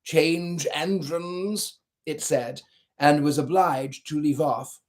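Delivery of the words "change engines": slow, hoarse and strained, like a horse. A narrator's reading. A horse-like passenger hoarsely calls for a change of engines. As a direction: drag the words out in a horse's hoarse rasp. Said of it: "his hoarse voice spoke next".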